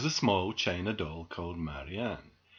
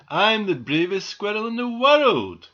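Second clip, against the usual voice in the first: high, loud bragging; boastful; 10 semitones higher, 9 dB louder than usual. A narrator's reading. Squirrel showing off on the roof, boastful. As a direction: brag it out for all to hear.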